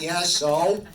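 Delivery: loud, shrugging "so?"; offhand so what. Retold or imitated. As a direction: toss it off as if it means nothing. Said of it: "Like that".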